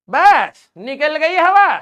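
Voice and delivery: high, loud, drawn-out jeer; loud mock taunt. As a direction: crow over a silenced heckler, slowly. Taunts student after mock scolding. (ऊँची आवाज़ में खींचकर चिढ़ाना)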